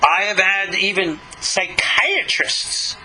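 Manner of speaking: usual speaking voice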